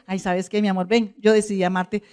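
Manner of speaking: suddenly soften, as if making peace; mock tender. Acting a spouse ending a fight with love, mock tender.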